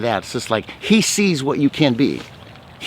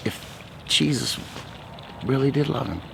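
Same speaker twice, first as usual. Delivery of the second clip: hushed; 8 dB quieter than usual. Real, not acted. Telling man's secret question.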